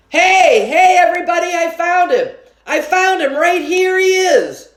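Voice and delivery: put-on boy shouting he found his brother; calling out. A narrator's reading. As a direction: announce it to the whole room. Calling out to whole theater.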